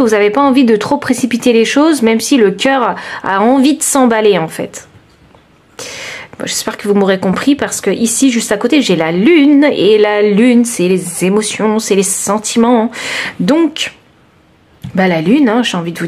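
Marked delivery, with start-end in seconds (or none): none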